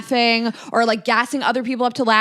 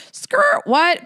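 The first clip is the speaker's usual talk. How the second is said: high, sudden squeak of surprise; mock shocked double take; 7 semitones higher, 4 dB louder than usual. Retelling a jab from someone, mock shocked squeak. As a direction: jolt up into a startled squeal. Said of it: "you're like".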